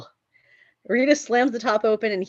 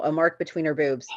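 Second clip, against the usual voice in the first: ordinary talk, nothing marked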